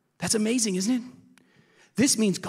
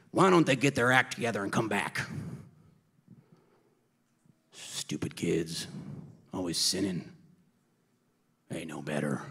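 put-on a disdainful father muttering about his kids; grumbling judge; 8 semitones lower than usual. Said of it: "He doesn't wait and say".

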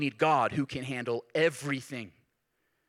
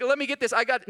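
mock clinging disciple; 8 semitones higher, 4 dB louder than usual.

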